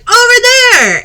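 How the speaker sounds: high, loud scream of "Over there!"; excited child yelling directions; kid scream. Acted. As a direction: shriek it out while hanging upside down. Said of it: "as he screams".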